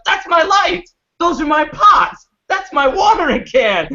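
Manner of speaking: very high, loud mock indignation; high-pitched hollering grandma impression; outraged grandma. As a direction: imitate grandma shouting each discovery in outrage. Grandma spots her stolen things, acted.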